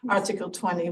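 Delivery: ordinary delivery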